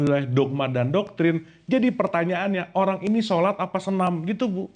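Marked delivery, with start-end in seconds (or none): none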